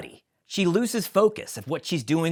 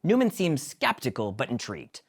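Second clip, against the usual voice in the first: usual speaking voice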